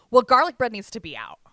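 imitating a bossy cook; mock demand. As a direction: bark the order like the cooks did. Mimicking cooks' demand.

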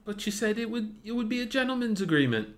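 high put-on British accent, mock indignant; a pretend Brit reminding a friend of a deal. British accent, a friend in a skit protests about an unwritten agreement. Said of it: "that was my British accent".

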